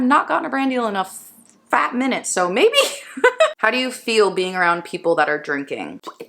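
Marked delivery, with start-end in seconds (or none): 2.8-3.5 short high giggle; bright rising giggle; high laugh. Real, not acted. Laugh after joke.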